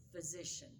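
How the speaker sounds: ordinary talk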